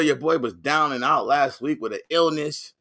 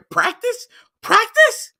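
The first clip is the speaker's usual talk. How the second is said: "practice" thrown up in a very high, disbelieving squeal; incredulous practice; 4 dB louder, slower than usual. Retold or imitated. Host riffing on the practice rant, squealing "practice" in disbelief.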